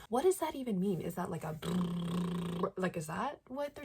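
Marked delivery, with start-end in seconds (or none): 0.1-1.0 loud, high puzzled question; genuinely thrown by it; baffled. Real, not acted. Confused by a book phrase. 1.5-2.7 slow rolled lips brrrr; lip trill drum roll. Shown as a demonstration. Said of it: "exaggerated drum roll noise with his lips".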